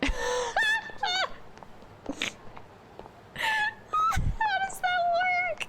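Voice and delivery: high laughing squeal; laughing disbelief. Real, not acted. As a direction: laugh through the words. Laughing at absurd game.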